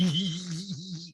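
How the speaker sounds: high, drawn-out mock giggle; Rinna-esque giggle. Host mimics reality star after confrontation, high put-on giggle. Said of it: "the laugh is kinda Rinna-esque".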